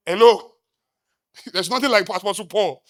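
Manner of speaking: ordinary delivery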